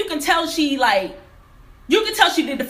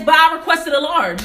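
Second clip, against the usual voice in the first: louder and higher, pushing back hard; angry insistence; 8 semitones higher, 7 dB louder than usual. Retold or imitated. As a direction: insist you asked for a large, heated. Arguing with the counter worker.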